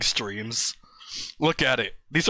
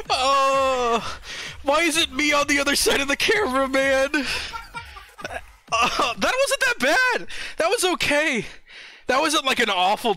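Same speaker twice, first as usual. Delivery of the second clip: giggly and high; 10 semitones higher than usual. Real, not acted. Laughing after the twerk.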